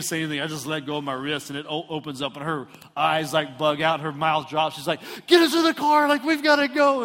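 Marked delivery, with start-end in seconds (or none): 5.3-7.0 high, loud frantic order; urgent, hammed-up yell to get moving; frantic mom. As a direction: imitate mom shouting in a panic. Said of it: "she's all in a frantic".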